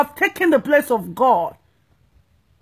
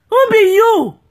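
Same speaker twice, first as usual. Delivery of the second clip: mocking challenge; 8 semitones higher, 5 dB louder than usual. Real, not acted. Mocking self-important pastors.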